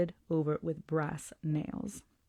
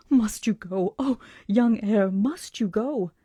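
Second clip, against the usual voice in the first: loud, pleading and frantic, higher; hysterical plea; 4 semitones higher, 7 dB louder, slower than usual. A narrator's reading. Old lady begging the traveller not to go, hysterical. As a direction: beg him not to leave, voice rising in panic. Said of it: "said in a hysterical way".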